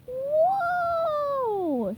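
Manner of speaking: long high drawn-out whoa; surprised. A narrator's reading. Little girl sees tiny sea creatures, high surprised whoa. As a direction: gasp out a stretched wondering whoa. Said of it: "said the surprised girl".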